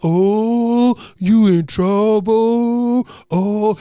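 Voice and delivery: long drawn-out, loud class chorus; taunting classmates. Retold or imitated. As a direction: stretch it out like a whole class teasing. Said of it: "everyone's like".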